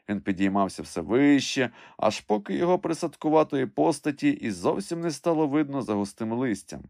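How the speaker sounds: usual speaking voice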